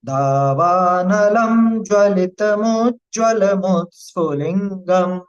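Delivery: slow chanted Sanskrit shloka, each syllable drawn out; slow verse recitation. Shown as a demonstration. Teacher chanting the shloka for the class, slow and measured. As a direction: intone the verse slowly and reverently.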